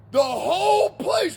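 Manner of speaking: ordinary talk, nothing marked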